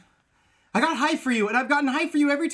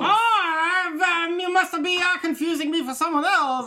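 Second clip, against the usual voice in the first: higher, hammy French waiter voice; French maitre d' accent; 6 semitones higher than usual. Acted. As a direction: play a snooty French maitre d'. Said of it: "Be a maitre d'".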